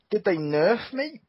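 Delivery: normal talking voice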